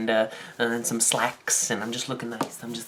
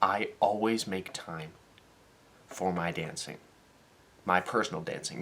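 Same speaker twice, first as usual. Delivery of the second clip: softer, earnest advice; sincere serious note; 7 dB quieter than usual. Real, not acted. To viewers about making time to dance, softly sincere. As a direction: speak it from the heart, quietly.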